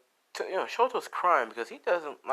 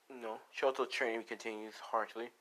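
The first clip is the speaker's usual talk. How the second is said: ordinary talk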